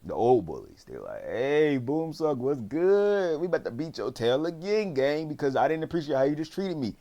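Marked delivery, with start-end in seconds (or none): 1.2-6.9 mock bully taunt. Old bullies finding Bumsuck, high taunting threat. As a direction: imitate a bully cornering his target.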